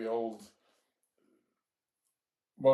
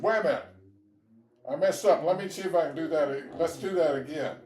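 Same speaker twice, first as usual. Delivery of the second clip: put-on made-up Georgia accent; 3 semitones higher, 7 dB louder than usual. Boy calls for a rematch after being flipped, loud mock Georgia accent. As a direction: play the beaten kid in a put-on Georgia accent. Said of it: "He didn't have a Georgia accent. I'm just making that up".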